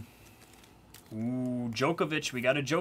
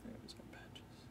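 whisper; 23 dB quieter, slower than usual. Real, not acted. Whispered aside.

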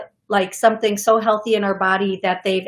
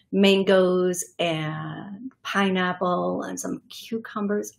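normal talking voice

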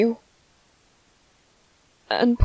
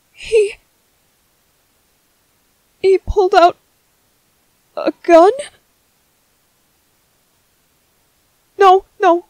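alarmed shock; 7 semitones higher, 7 dB louder than usual. Acted. Alarmed at hearing of a gun.

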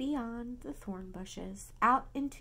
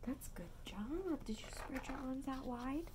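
hushed bedtime whisper; soft unvoiced whisper; 4 semitones higher, 11 dB quieter than usual. Real, not acted. Whispered aside to baby.

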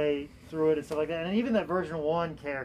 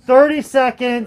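slow, louder, higher time call; loud announcement; 6 semitones higher, 12 dB louder, slower than usual. Real, not acted. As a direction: call out the time left to the room. Auction host calling time.

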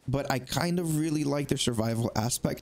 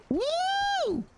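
very high victory woo; triumphant whoop. Real, not acted. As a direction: whoop at the kill. Kills the creature.

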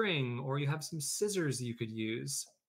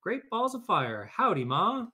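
high, loud cowboy gosling; a hammed-up southern baby goose; 9 semitones higher, 6 dB louder than usual. A narrator's reading. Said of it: "who looked up at Jack and said".

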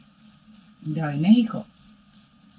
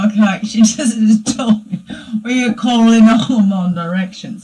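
normal talking voice

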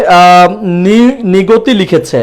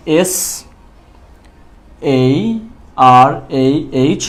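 very slow letters, lower pitch; spelling while writing; 4 semitones lower, 4 dB quieter, much slower than usual. Shown as a demonstration. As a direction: spell it out as you write. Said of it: "আর এখানে লিখি".

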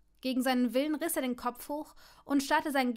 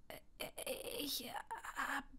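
faltering, barely there stammer; words die in the throat; voice failing; 3 semitones higher, 11 dB quieter, slower than usual. Acted. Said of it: "seine Stimme versagte".

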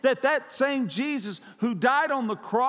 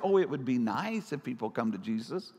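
lukewarm wish; 10 semitones lower, 4 dB quieter than usual. Retold or imitated. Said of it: "not just saying".

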